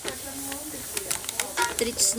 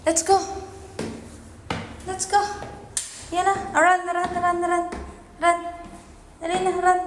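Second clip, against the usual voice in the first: urging cat on; 5 semitones higher than usual. Real, not acted.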